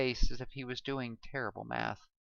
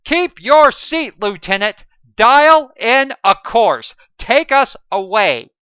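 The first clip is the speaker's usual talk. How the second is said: angry snapped orders; 11 semitones higher, 21 dB louder than usual. A narrator's reading. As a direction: slam down orders on the lieutenant. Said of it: "he snapped".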